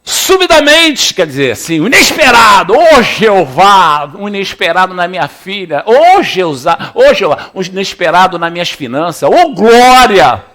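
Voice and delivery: fiery preaching. Real, not acted. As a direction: preach it full force.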